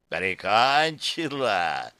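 slow mocking demon echo; snide demon voice. A narrator's reading. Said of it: "прозвучал ехидный голос демона".

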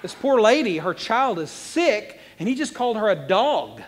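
high, loud, drawn-out protest; loud outrage. Acted. Preacher defending the woman called a dog, loud outrage. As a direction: protest slowly and forcefully on her behalf.

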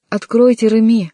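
high, loud desperate call. A narrator's reading. Frantic young man at the locked door, loud shout. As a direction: shout through the door, losing composure. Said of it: "ещё громче закричал молодой человек".

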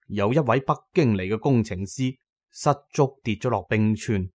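usual speaking voice

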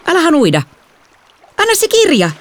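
high-pitched snappy yell; shrieked order; demanding child. A narrator's reading. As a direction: demand it back as an annoyed kid. Sister grabs for her book.

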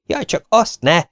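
high-pitched, alarmed plea; terrified cry. A narrator's reading. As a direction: cry out in fright, begging. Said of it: "kiáltott rémülten Ahmed". (rémült, magas hangú kiáltás)

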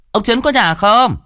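loud high call across yard. A narrator's reading. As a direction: shout the question toward the house. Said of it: "chạy vào sân gọi".

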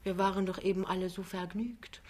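soft, bewildered protest; gentle, puzzled young wife; baffled. A narrator's reading. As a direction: wonder aloud, quietly. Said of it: "fragt Lämmchen verblüfft".

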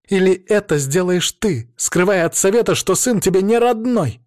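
high, heated accusing question; blurted retort. A narrator's reading. Daughter exposes her father's secret to the council, blurted retort. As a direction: blurt it out in anger. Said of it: "выпаливает Ариадна".

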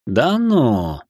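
drawn-out mock amazement, swooping up and down; feigned surprise. A narrator's reading. Ignis answering her grumble about being known, feigned surprise. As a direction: play at being astonished. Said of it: "округлил глаза в притворном удивлении".

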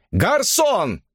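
high-pitched call for the waiter. A narrator's reading. Man getting into the role calls the waiter, calling out. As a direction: summon the waiter, pitch leaping up. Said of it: "позвал он".

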